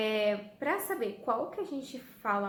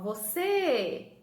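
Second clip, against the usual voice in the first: "você" with a long, loud CÊ; stretched stressed syllable; 3 semitones higher, 6 dB louder than usual. Shown as a demonstration. Teacher showing pupils the long syllable, CÊ stretched in "você". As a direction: call "você", holding the final CÊ. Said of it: "Qual que eu estendi".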